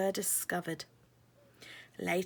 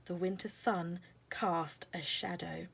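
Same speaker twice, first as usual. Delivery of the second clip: plain reading voice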